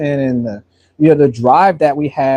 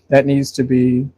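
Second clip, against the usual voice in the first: talking through hiccups; hiccupy, interrupted words; 3 semitones lower than usual. Real, not acted. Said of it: "well, I got the hiccups".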